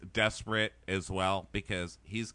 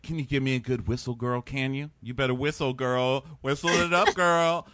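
loud mock cheerleading, building higher; sassy egging-on; 4 semitones higher, 6 dB louder than usual. Robbie urges her to whistle, mock sassy hype. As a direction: imitate him hyping her up, louder each time.

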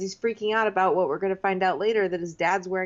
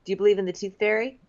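quoting Dean; much faster than usual. Retold or imitated. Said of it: "And he's like".

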